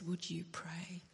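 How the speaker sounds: the engineer's plea recalled in a slow hush. Retold or imitated. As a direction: whisper it gently, as if at a bedside. Retelling the engineer's hushed request.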